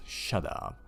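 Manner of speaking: usual speaking voice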